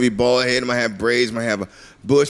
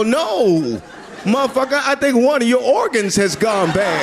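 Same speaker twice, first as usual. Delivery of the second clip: higher, laughing shout; mock retort; 7 semitones higher than usual. Telling a friend his breath stinks, mock outrage. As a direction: fire back in mock outrage. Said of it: "And you go".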